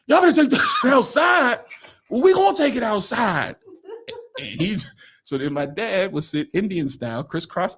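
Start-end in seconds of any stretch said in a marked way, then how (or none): 0.1-1.6 high, shouted mock order; acted scolding. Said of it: "Debbie lit into everybody". 2.1-3.6 mock defiant retort. Men shout back at Debbie.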